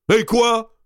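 high, loud blurted exclamation; crude outburst. Acted. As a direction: cry it out tactlessly. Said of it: "s'écria d'Artois avec sa délicatesse coutumière".

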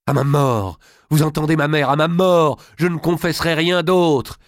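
high, loud impassioned oath; vehement defiance. Acted. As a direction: swear it to your mother, refusing to yield. Daughter refuses any other confession.

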